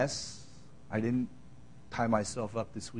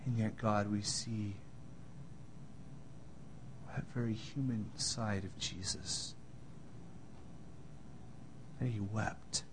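closing prayer. Acted. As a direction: pray humbly before the church. Pastor closes in prayer.

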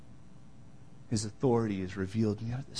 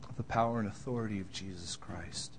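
closing prayer. Acted. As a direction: pray humbly before the church. Pastor closes in prayer.

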